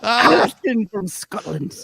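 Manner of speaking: slow, drawn-out mock Scottish accent; playful Scottish announcement. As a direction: put on a Scottish accent, stretching each word. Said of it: "Accent, uh, please".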